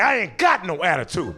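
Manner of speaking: sassy, swooping denial; hammed-up back talk; mock attitude. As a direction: deny it with exaggerated attitude. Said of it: "but I said it like that".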